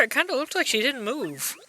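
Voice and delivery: usual speaking voice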